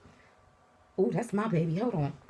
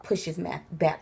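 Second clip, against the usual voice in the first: ordinary talk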